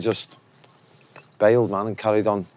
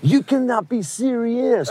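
much higher, slow mock tennis rant with a laugh; John McEnroe outburst; 13 semitones higher, slower than usual. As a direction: stretch it out like a furious umpire complaint. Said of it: "He goes".

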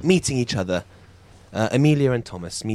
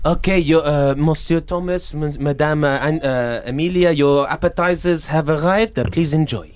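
courteous French maître d'; hesitant, polite French host; French waiter; 3 semitones higher than usual. Acted. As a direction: welcome guests in a French accent. Plays French restaurant host.

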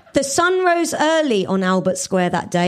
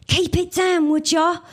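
a hammed-up, higher hissed complaint; 8 semitones higher than usual. [name] telling the bin trucks to keep it down, mock hiss. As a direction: snap it at the bin lorry under your breath. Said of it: "hissed [name]".

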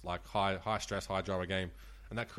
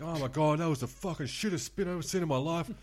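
raised, high-pitched outraged gambler; going berserk; 7 semitones higher than usual. Retold or imitated. Drunk gambler complaining to the roulette dealer, going berserk. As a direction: retell his over-the-top outburst in a high voice. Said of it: "then he's gone spastic".

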